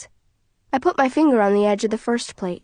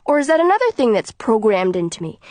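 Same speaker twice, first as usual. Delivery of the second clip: plain reading voice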